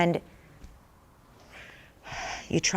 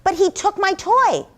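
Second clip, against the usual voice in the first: put-on high, loud little-kid protest; child's indignant, raised-pitch complaint; 11 semitones higher, 5 dB louder than usual. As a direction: protest like an aggrieved small girl. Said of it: "And she'll say".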